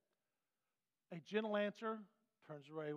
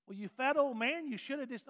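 higher, louder insult from the clerk; hammed-up rude retort; comes back hard; 6 semitones higher, 6 dB louder than usual. Clerk snapping back at a griping customer, loud mock insult. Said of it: "if she comes back hard".

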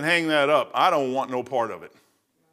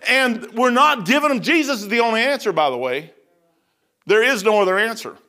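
ordinary delivery

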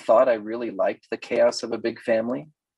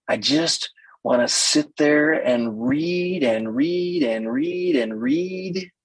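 slow, drawn-out mock bookworm; dreamy bookworm; slower than usual. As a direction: stretch every "read" as if sinking into the pages.

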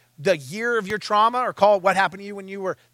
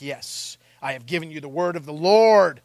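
acting a self-assured prophet; bold man of God; 4 semitones lower than usual. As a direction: proclaim it grandly, slowing at the end. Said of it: "you see the boldness of the man of God".

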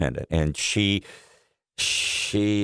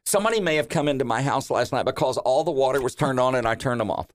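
higher, quick, unbothered mom voice; imitating mom on phone; 6 semitones higher, faster than usual. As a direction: breezily report the water mystery as mom.

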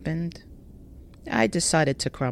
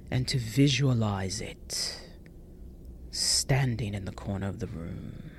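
eerie whisper; 5 semitones lower, 5 dB quieter than usual. Retold or imitated. Retelling the video's eerie instructions.

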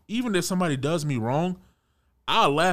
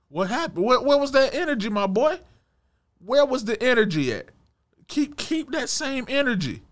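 high, mocking call-out, half laughing; mocking challenge; 10 semitones higher than usual. Confronting the guy who backed down.

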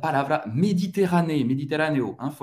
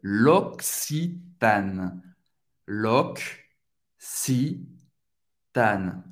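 very slow "L'Occitane" as lok... see... TAN; pause between Cs; 4 semitones lower, much slower than usual. Shown as a demonstration. Teacher splitting the brand for learners, pause between Cs. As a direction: break "L'Occitane" after the K sound. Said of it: "fazer uma pausa entre o primeiro C e o segundo C".

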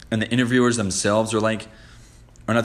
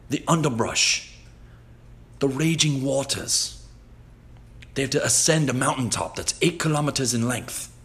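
put-on light British presenter accent, a bit higher; Bear Grylls impression; 4 semitones higher than usual. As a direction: lightly imitate a British host describing terrain. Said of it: "got his British accent and he's talking like this".

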